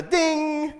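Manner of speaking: high, held warning-light ding; imitated chime. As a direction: sing out a dashboard chime.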